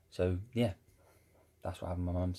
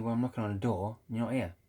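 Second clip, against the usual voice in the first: mock-insistent granddad on the phone, a bit louder; lightly acted granddad, pitched up; retold granddad; 3 semitones higher, 5 dB louder than usual. Granddad phoning from the doorstep, lightly imitated insistence. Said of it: "my granddad rang me up".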